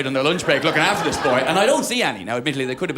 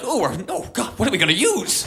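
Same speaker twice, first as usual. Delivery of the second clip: mock panic; 6 semitones higher than usual.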